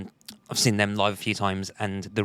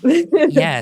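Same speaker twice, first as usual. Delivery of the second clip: a laughing yeah, far higher and much louder; 14 dB louder than usual. Real, not acted. Host answers while laughing.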